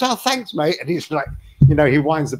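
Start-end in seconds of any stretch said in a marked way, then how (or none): 0.0-0.8 high, chirpy mock thanks; a quick bright higher thank-you; cheery thanks. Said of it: "He goes".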